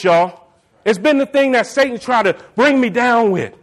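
ordinary delivery

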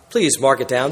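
normal talking voice

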